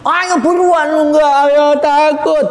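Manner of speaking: very high, mock-frightened cry; acted panic. People being hurried over the fiery bridge, acted panicked wailing. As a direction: cry out as if too scared to cross. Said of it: "itu ada yang begitu nanti".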